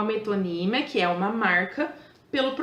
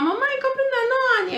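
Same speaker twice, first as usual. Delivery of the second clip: put-on high child voice, much higher; high-pitched child asking mom for yogurt; little daughter asking; 14 semitones higher than usual. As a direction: pipe it up like a small girl begging. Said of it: "a minha filha vira e fala".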